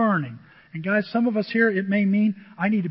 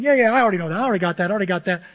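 impatient brush-off; 6 dB louder, much faster than usual. Retold or imitated. Congregant cutting off the pastor's advice, loud and impatient. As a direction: cut them off, I already know. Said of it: "you start going".

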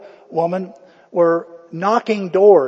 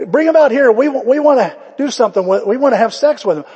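high, loud rowdy demand; hammed-up mob shouting; 6 semitones higher, 6 dB louder, faster than usual. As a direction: bark it at the door.

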